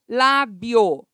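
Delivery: "Lábio" loud, as lá-bio; syllable by syllable. Shown as a demonstration. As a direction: split "Lábio" firmly into two beats. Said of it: "o iô está junto".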